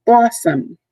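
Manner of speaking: teacher demonstrating "Blossom", BLOSS stressed; stress on first syllable. Shown as a demonstration. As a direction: punch the start of "Blossom", then let it fall. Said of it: "It gets a little more inflection".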